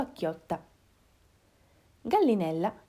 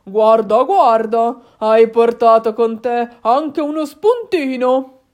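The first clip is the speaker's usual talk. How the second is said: loud, slow, savouring voice; big hungry fox, drawling loudly; gloating father fox; 11 dB louder, slower than usual. Acted. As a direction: relish the sight of a snack. Father fox eyes hen as snack.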